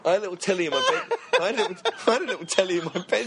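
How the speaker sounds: high, fast, through real laughter; laughing while talking. Real, not acted. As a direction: struggle to get it out while cracking up. Helpless laughter at own story.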